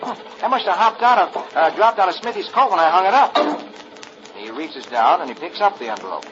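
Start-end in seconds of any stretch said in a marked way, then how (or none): 0.0-3.4 put-on somewhat higher boyish voice; a kid gentling a horse; Rusty the boy. Rusty to the horse Big Blaze, a boyish higher voice.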